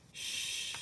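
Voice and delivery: unvoiced shushing; hush. A narrator's reading. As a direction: hush her gently, breathy shh. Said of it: "said Louise".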